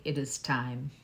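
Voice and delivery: low, soft, badly faked sage voice; half-hearted character impression, hushed and low; Rafiki. Reader quoting the voice she heard, a poor low Rafiki impression. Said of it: "That was the worst impression ever".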